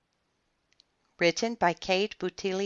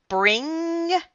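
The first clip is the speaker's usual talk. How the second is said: high, rising ring; bell imitation; 12 semitones higher, 4 dB louder than usual. A narrator's reading. Narrator voicing the downstairs bell, high ring. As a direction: trill it upward like a bell.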